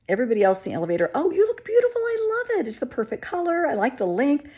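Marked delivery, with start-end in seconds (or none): 1.1-4.5 much higher, loud gushing compliments; gushing coworkers. Retold or imitated. Coworkers in the elevator praising her wig, high and gushing. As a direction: gush sweetly over the new wig.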